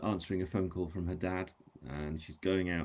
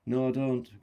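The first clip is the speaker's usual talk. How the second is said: higher, louder firm refusal; pointed; 5 semitones higher, 6 dB louder, slower than usual. A narrator's reading. Grace turns down Harry's company, a little too pointedly. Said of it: "a little more pointedly than she'd meant to".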